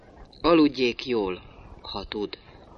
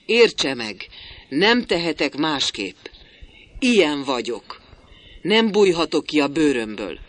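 louder, higher pleading; almost desperate; 5 semitones higher, 7 dB louder than usual. A narrator's reading. Builder hurrying after Brovik, almost desperate plea. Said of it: "szinte kétségbe esetten".

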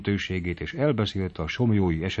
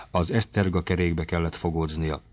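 ordinary delivery

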